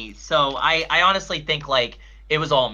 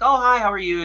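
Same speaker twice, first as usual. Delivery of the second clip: higher, lightly imitated greeting; perky greeting; 6 semitones higher than usual. Fan voices idol greeting him, higher and perky. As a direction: lift it like a friendly idol saying hi. Voicing idol's English greeting.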